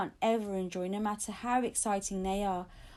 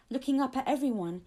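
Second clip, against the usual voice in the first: plain reading voice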